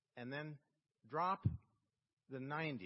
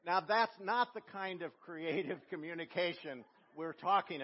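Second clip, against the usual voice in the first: loud, ringing pulpit voice; preaching; 3 semitones higher, 7 dB louder than usual. Acted. Preacher proclaiming the opening of John's Gospel, loud. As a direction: proclaim it to the whole church.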